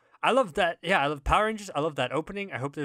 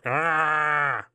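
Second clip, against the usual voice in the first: zombie moan; 2 semitones higher, 5 dB louder than usual. Acted. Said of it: "That's how a zombie would say hello".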